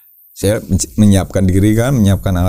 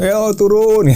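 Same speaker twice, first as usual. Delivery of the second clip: calling out; 12 semitones higher than usual. Retold or imitated. Friends calling down the cave.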